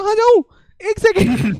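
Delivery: high, loud mock exasperation; Munawar Faruqui. Retelling a comedian's joke to a co-host, exasperated. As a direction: retell it as a baffled, high-pitched outburst. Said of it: "मैं कहा".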